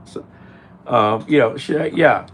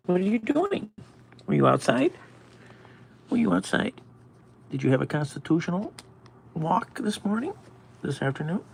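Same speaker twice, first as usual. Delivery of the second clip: mock nosy supervisor; 7 semitones higher, 5 dB quieter, faster than usual. Imitating nosy supervisor questions.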